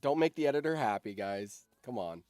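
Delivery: ordinary talk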